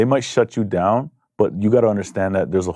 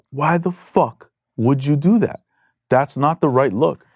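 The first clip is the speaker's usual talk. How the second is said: slow, higher-pitched imitation of a boss's rebuke; retold exasperated scolding; 6 semitones higher, slower than usual. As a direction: spell out the disapproval slowly. Retold boss scolding manager.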